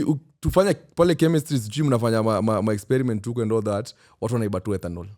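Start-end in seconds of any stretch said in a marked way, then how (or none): none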